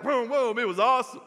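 excited. Retold or imitated. Preacher reliving his dad's bike ride, excited. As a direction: relive the thrill of the bike roaring off.

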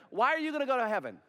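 rapid-fire acted question, lifted in pitch; quick challenge. Preacher plays someone sharing the gospel, quick challenging question. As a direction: fire the question straight back.

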